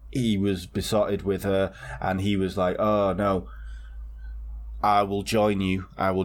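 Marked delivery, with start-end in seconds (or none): none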